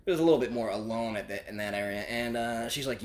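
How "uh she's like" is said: normal talking voice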